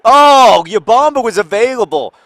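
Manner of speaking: high, loud gleeful yell; excited shout. Retold or imitated. Son cheering at his game, loud excited shout. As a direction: burst out like a kid whose team scored.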